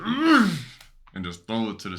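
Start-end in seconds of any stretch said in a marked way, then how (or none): 0.0-0.5 loud, high frustrated groan; angry groan. Retold or imitated. Retelling post-release anger, loud high ugh. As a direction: groan it out in disgust after the act. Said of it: "I'd be like".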